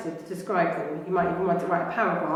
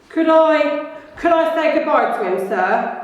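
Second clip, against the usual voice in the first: higher, louder giant's plea; Hagrid pleading; 7 semitones higher, 5 dB louder than usual. Acted. Hagrid asking Dumbledore to say goodbye to the baby. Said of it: "asked Hagrid".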